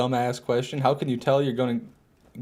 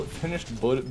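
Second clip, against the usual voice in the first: ordinary talk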